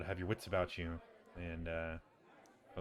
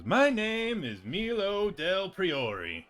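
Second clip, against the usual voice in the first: high, loud and very slow, a grand self-introduction; pompous Milo voice; 11 semitones higher, 7 dB louder, much slower than usual. Acted. Milo stands and proudly introduces himself to the party, high and slow. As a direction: announce yourself like a self-important wizard. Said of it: "Milo gets up, he says".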